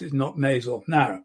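normal talking voice

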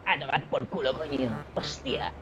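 mock Castilian Spanish, stretching the final word; caricatured peninsular Spanish accent; Spain accent. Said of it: "en español de España".

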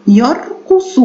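يرقص in syllables, ending on short "su"; yar-qu-su, each part set apart, for يرقص; careful teaching take of يرقص; model pronunciation. Shown as a demonstration. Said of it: "حركتها الضمة".

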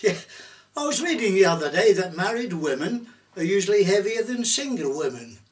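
ordinary talk

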